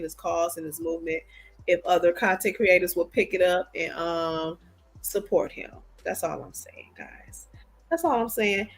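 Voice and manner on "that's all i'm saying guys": unvoiced whisper, confiding to viewers. Real, not acted. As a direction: whisper it like a little secret. Aside to viewers, then repeated.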